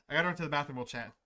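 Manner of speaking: ordinary delivery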